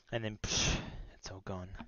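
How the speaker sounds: ordinary delivery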